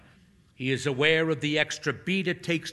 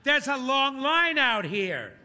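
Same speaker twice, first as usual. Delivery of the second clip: put-on high, far-off young man's voice; muffled call; 12 semitones higher than usual. A narrator's reading. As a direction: call through the booth wall. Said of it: "a young man's muffled voice calls out".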